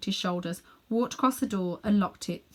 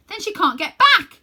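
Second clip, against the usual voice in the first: put-on high, loud boyish shout; outraged shout; 12 semitones higher, 10 dB louder than usual. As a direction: yell it at him in alarm. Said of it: "shouted Diggory".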